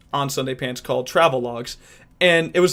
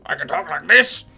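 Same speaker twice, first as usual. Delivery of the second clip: quick character voice, rising at the end; put-on voice; 3 dB louder than usual. Shown as a demonstration. Actor sampling different voices, put-on voice. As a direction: switch into another character for a moment. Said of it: "all these different voices I could do".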